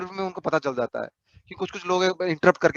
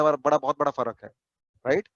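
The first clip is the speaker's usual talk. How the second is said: normal talking voice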